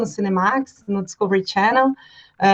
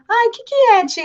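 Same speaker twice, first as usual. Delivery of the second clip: put-on much higher, a small child asking her aunt; little girl voice; 12 semitones higher than usual. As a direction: pitch up like a toddler of three or four. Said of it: "E aí ela".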